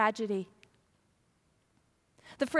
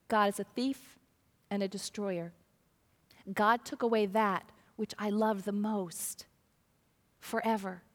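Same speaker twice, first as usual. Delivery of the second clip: low, soft and heavy, a lightly voiced grieving parent; quiet despair in the voice; mock grief; 4 semitones lower, 4 dB quieter than usual. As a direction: let the words sink down in sorrow. What grieving parents hear.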